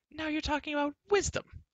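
very high, indignant exclamation; scornful cry. A narrator's reading. Girl mocking Taryn's talk of wisdom, scornful cry. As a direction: squeal it in disbelief, pitch held high. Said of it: "Elenwy cried".

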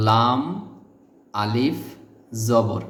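normal talking voice

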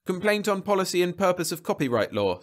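high-pitched, nervous report. A narrator's reading. Monitor calling his supervisor, nervous. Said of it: "said Jeb nervously".

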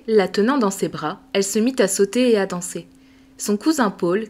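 usual speaking voice

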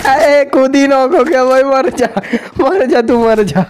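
high, gloating cries of die; mock the enemy with glee; gleeful taunt. Real, not acted. Gamer mocking an enemy who knocked himself out, high gleeful taunting.

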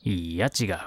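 put-on curt, low old man reply; ぶっきらぼう. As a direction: brush them off gruffly. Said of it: "ぶっきらぼうに答えつつ". (低くぶっきらぼうな老人の声)

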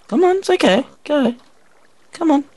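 high coaxing voice to a dog; talking to a pet. Real, not acted. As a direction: sweet-talk the dog over.

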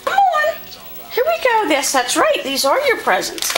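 talking to a dog. Real, not acted. Coaxing the dogs.

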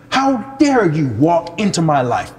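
loud, high preaching rebuke. Retold or imitated. Preacher rebuking the stranger, loud and indignant. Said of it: "Sorry, I'm preaching".

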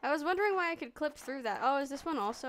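ordinary talk, nothing marked

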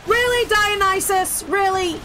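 drawn-out, high disbelieving "really"; incredulous, stretching each word out; exasperated. Real, not acted. Exasperated at game god.

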